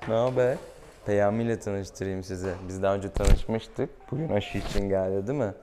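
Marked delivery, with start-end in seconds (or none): none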